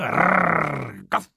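loud rolled growl, then a bark; dog growl and bark. A narrator's reading. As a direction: cut in as a snarling dog. Dog growl interrupts the song. (рычание и лай собаки)

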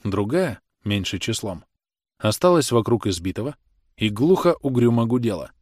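ordinary delivery, nothing marked

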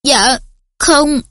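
bitter. A narrator's reading. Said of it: "cay đắng nói".

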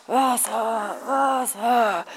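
lightly voiced, rasping thirsty plea; parched croak. A thirsty man in the desert, lightly voiced. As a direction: beg for water, faintly. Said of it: "ist total durstig".

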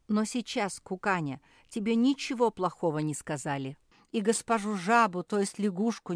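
urgent peacemaker's plea; pleading. A narrator's reading. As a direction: reason with them imploringly. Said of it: "взмолился Филипп".

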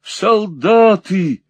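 slow high call; drawn-out high cry; exclaimed. Acted. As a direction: stretch the word out high and loud. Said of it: "вскричал мужчина".

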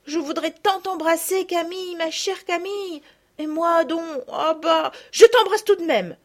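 lightly voiced eager friend; eager girl. A narrator's reading. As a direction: rush in fondly.

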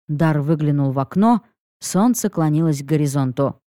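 ordinary delivery

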